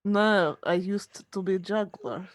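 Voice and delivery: low, mock-Russian actor voice; Russian accent. Actor explaining his trick to crew, Russian accent. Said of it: "more Russian sounding".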